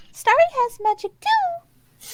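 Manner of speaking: put-on high, cheery little girl voice; bright magical girl. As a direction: chirp it out like a kid. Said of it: "Starry will say".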